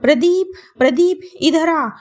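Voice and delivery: calling out high and loud; shouting for her son. A narrator's reading. Mother-in-law calls her son into the kitchen, loud and high. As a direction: yell the name across the house. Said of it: "चिल्लाना शुरू कर दिया".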